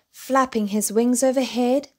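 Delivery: plain reading voice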